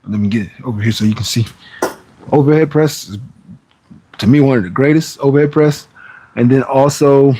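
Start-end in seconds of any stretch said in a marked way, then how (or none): none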